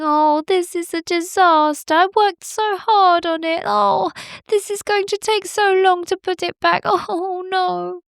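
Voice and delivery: high, weepy little bird voice; crying bird. Acted. Bowerbird mourning its broken nest, high and tearful. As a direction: wail over the ruined nest. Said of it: "He heard somebody crying".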